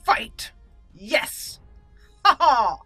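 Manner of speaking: loud, punchy cheer with a triumphant laugh; real burst of gleeful excitement; excited battle cry. Real, not acted. Player echoing the announcer as the duel begins, excited.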